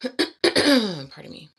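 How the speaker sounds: loud, very slow pardon me; drawn-out mock politeness; mock formal pardon. Real, not acted. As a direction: overplay the polite apology. After clearing her throat.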